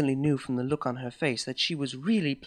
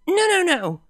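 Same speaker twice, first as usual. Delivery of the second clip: higher, louder, brushing her off; dismissive; 8 dB louder than usual. Acted. Mare cuts her girlfriend off mid-sentence, dismissive. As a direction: wave her story away impatiently. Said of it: "I said dismissively".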